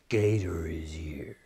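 low, slow whispered dread; creepy low whisper; ominous whisper. A narrator's reading. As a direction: hush it like danger is near. Ominous arrival of the gator.

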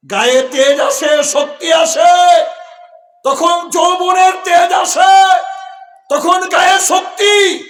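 high, loud, stretched-out phrases; rousing preacher's shout. Real, not acted. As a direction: drive each phrase up into a drawn-out shout. যৌবনের তেজ জোরে বোঝাচ্ছেন. (জোরালো টানা হাঁক)

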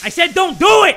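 loud, high scolding shout; high-pitched indignant outburst; exasperated yell. Pretend. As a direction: yell it as a frantic order. Scolds the game after it acts.

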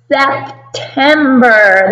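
SEP-TEM-BER, slow, each syllable apart; syllables clapped out. Shown as a demonstration. Teacher modelling September syllable by syllable for kindergarten. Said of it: "Can you say September and clap with me".

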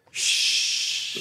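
shushing. Shown as a demonstration. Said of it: "What do they say about library?".

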